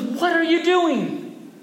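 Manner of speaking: high, drawn-out incredulous cry; exasperated outcry. Acted. Son to his yelling father, high exasperated cry. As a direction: cry it out in comic alarm.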